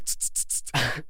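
whispered tss sound effects; falling noise. Retold or imitated. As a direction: hiss out a sinking stock chart. Imitating a stock dropping.

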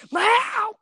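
shrill shouted cat meow; high yelled meow. Retold or imitated. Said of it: "He goes".

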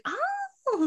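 very high gushing Oh; hammed-up gushing guest; mock fawning guest. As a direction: coo at him admiringly. Guests fawning over Chris.

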